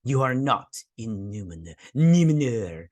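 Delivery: hammed-up, measured pronouncement; mock regal rebuke, last word exaggerated; imitating the queen. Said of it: "she's like".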